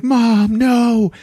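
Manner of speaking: high pained wail; mock high anguished cry. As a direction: cry out in grief. Jake crying over his mother's ashes.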